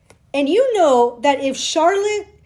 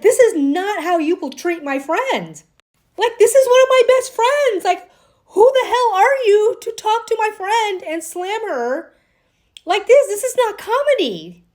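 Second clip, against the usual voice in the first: chewing someone out; 7 semitones higher than usual. Retold or imitated. Carrie confronting Che over insulting her friend, rising angry telling-off. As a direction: defend your best friend, furious. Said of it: "Carrie should have chewed out Che".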